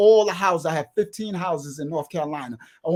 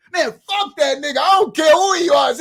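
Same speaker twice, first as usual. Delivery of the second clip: higher and louder defiance; retold defiant shout, higher; 9 semitones higher, 7 dB louder than usual. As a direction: throw it out loud. Defiant outburst.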